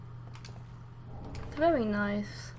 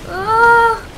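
high, loud yelps of astonishment; shocked disbelief; 7 semitones higher, 10 dB louder, slower than usual. Real, not acted. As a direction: blurt it out loudly in stunned disbelief. Shock at game sight, shouting.

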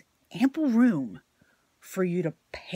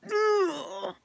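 aggravated groan; 12 semitones higher than usual. Retold or imitated. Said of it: "It just went like".

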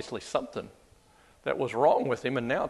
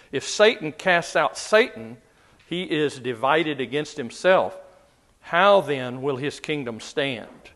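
louder, measured Bible reading; scripture reading; 4 dB louder than usual. Acted. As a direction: read the verses out to the class with weight. Reads scripture passage aloud.

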